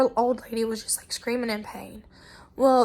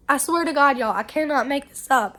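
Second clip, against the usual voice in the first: ordinary talk, nothing marked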